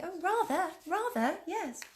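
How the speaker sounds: exaggerated posh child voice. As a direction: lampoon a posh little child saying "rather". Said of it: "It's all very posh".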